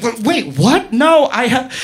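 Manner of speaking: stammering, high-pitched mock disbelief; flustered refusal. Woman balking at the man's request, flustered and high. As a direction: trip over your words in alarmed protest.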